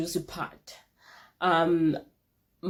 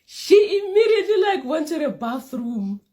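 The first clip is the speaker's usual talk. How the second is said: laughing; 11 semitones higher, 7 dB louder than usual. Real, not acted. Laughing high burst.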